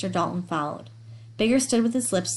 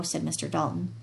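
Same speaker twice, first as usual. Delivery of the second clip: ordinary delivery, nothing marked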